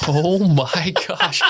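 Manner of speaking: laughing high and drawn out; a slow, high laughing gasp. Real, not acted. Stumped by a trivia question.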